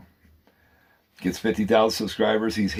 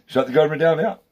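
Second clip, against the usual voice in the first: higher, louder demand; ranting shout; 8 semitones higher, 5 dB louder than usual. Real, not acted. Ranting to his viewers, a loud higher demand. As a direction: bark it out as a rallying call.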